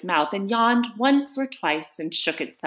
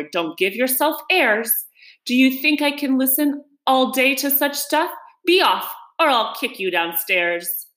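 raised, emphatic telling-off; fed-up father sending his son away; impatient scolding; 6 semitones higher, 3 dB louder than usual. Acted. Father refuses more questions from his son, impatient scolding.

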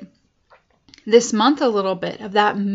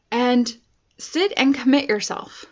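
ordinary talk

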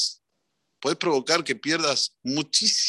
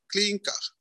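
a small, quiet, higher cash-register clink; high, softer mock ding; 6 semitones higher, 5 dB quieter than usual. The next donor pays up, quiet imitated clink. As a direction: let the coin fall in lightly. Coins dropping into the till.

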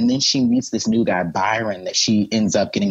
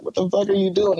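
higher, outraged mock protest; indignant groom; 9 semitones higher than usual. Byron confronting the man who interrupts his wedding, higher outrage. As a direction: play the jilted groom's disbelief. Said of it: "Byron is like".

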